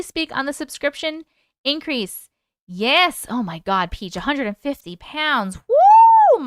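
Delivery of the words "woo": high loud whoop; excited cheer. Real, not acted. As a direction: whoop for her, big and high. Cheering a viewer's weight loss.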